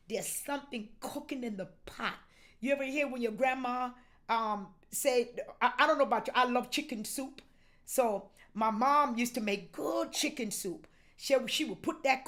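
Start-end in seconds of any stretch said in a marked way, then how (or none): none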